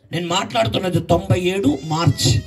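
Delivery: ordinary talk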